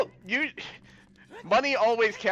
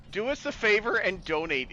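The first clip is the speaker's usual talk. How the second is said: normal talking voice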